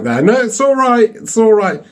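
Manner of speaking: high-pitched, placating victim; squeaky mock reassurance. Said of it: "She's like".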